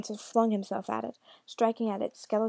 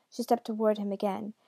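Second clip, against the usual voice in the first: usual speaking voice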